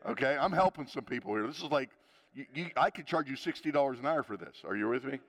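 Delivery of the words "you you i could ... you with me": ordinary talk, nothing marked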